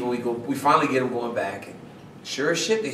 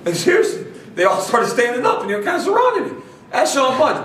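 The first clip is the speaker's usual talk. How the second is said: excited retelling; 10 semitones higher, 4 dB louder than usual. Real, not acted. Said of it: "excited retelling of being surrounded".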